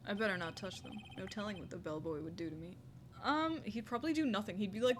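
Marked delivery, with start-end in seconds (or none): none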